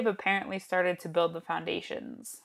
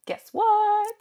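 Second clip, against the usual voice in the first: excited; 11 semitones higher, 9 dB louder, much slower than usual. Real, not acted. Announcing big news to co-host.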